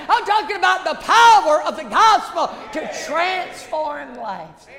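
high, loud shouting preacher; fiery revival shout; preaching at full force. Acted. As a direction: lift the room, shout it out. Preacher climaxes about transformed lives.

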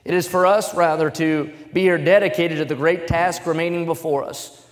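stirring speech recitation; oratory reading. Acted. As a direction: proclaim it like a formal address. Reads Gettysburg Address aloud.

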